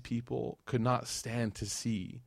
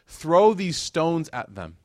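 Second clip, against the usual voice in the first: louder, slowed-down command; slow commanding order; 5 semitones higher, 8 dB louder, much slower than usual. Retold or imitated. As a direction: drag out each word as an order. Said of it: "they're commanding their entire city".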